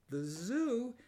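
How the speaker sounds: high, dismayed rising cry on zoo; miserable exclamation. Acted. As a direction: gasp it out in unhappy disbelief. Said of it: "Missus Prim exclaimed miserably".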